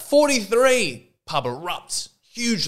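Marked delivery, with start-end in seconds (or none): 0.0-1.1 slow, high, loud shout; a pub regular shouting a joke number, stretched out; yelling out. Acted. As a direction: bellow the number across the room. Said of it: "yells out".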